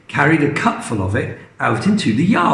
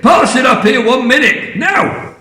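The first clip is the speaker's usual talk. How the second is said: high, louder scolding; bossy snap; 9 semitones higher, 4 dB louder than usual. Acted. Grandma accusing George of lying, loud scolding. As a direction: scold the boy sharply.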